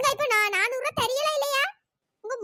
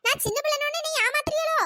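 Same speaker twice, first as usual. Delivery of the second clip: higher, angry complaint; raised voice; 5 semitones higher than usual. Acted. Girl angrily accuses the older woman of cheating her with the ring. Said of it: "voice எகுறுது".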